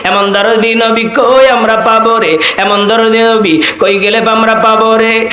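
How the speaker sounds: tearful-style chanted storytelling; imitated preacher's melodic wail; Tofazzol Bhairabi impression. Shown as a demonstration. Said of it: "ওনার মতো হুবহু হয়েছে তো".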